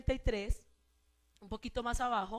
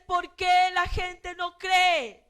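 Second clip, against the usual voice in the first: preacher voicing Jesus' grief at unbelief; impassioned preaching; 5 semitones higher, 6 dB louder than usual. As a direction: plead it out over the music. Preaching at full force as Jesus.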